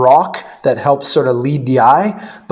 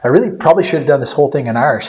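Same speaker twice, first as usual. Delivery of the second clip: ordinary delivery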